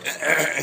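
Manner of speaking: discreet acted "ahem"; polite mock throat-clear; waiter's throat-clearing. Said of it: "you're a waiter and you're like".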